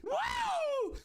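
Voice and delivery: very high, held celebratory whoop; ecstatic whoop. Real, not acted. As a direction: whoop at the top of your range. Celebrating a goal.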